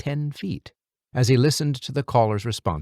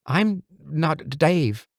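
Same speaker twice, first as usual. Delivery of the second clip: stammering; 6 semitones higher, much slower than usual. A narrator's reading. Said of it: "Eli stammered".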